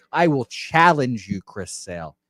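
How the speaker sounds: ordinary delivery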